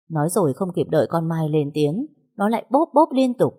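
ordinary delivery

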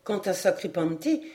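ordinary delivery, nothing marked

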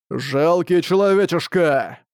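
slow, booming monstrous voice; monster roar. Acted. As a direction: roar it like a giant beast. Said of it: "взревел босс".

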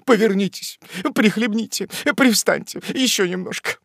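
high-pitched, fussing sympathy; compassionate old woman. A narrator's reading. The landlady tends the fainted aunt, compassionate old woman. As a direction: coo over the sufferer in short, high urgings. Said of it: "отозвалась сострадательная старушка".

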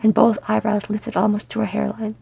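ordinary delivery

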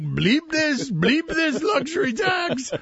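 put-on clumsy Russian accent; bad Russian accent. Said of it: "The terrible Russian accent by me".

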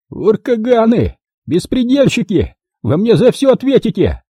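high-pitched, helpless rage; impotently furious shout. A narrator's reading. Puzyr curses the attackers as he retreats, furious shout. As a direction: cry out in powerless spite. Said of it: "В бессильной злобе закричал Пузырь".

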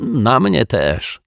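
high, drawn-out aggrieved reply; indignant, slow agreement; irritated confirmation. A narrator's reading. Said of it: "opowiadał poirytowany pan Mietek".

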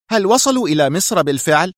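high, agitated question; nervous. A narrator's reading. Sonia asks whether they reached Egypt, nervously. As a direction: press nervously for an answer. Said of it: "وسألته في عصبية".

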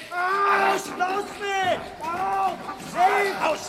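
high, loud yelling while being grabbed; struggling shout. Acted. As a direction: fight them off, shouting. Gefangener wehrt sich. (schreiend im Handgemenge)

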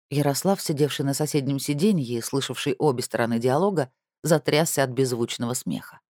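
plain reading voice

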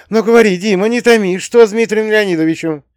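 high, loud, anxious urging; urgent pleading. A narrator's reading. Mother begs her son for news of her husband, urgent pleading. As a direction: beg him not to hold back.

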